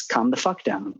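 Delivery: blunt, no-nonsense version of calm down; blunt vets version. Shown as a demonstration. To vets, the blunt version of calming down, said flatly. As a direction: say it like a tough order to a veteran. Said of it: "When I talk to vets".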